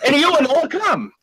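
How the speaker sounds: hammed-up Italian rage; furious old immigrant. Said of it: "and the guy".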